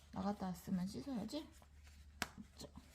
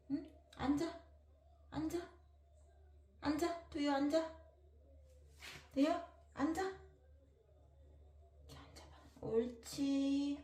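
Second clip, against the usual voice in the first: talking to a dog; 8 semitones higher, much faster than usual. Real, not acted. Commanding dog to sit.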